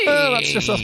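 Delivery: high squeaky laughing voice. Acted. Laughing falsetto at own jingle.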